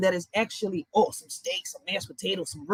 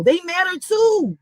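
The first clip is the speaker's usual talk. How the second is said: higher, louder and slow, pressing the point; earnest plea; 8 semitones higher, 5 dB louder, slower than usual. Real, not acted. Defending animals against meat eaters, slow earnest plea. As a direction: insist the animals matter.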